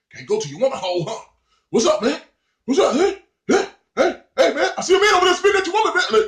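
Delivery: high, loud, fast jeering fan; courtside heckler. Retold or imitated. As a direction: taunt him from the stands.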